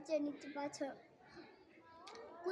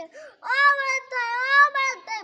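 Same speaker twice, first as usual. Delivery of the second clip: slow, drawn-out high shout; loud calling out; 8 semitones higher, 17 dB louder, slower than usual. Acted. Hiding rabbit calls the bull for help, slow loud high shout. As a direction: stretch the call out loud and high.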